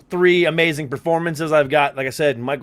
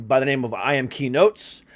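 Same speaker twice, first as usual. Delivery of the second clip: ordinary talk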